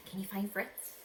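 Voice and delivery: plain reading voice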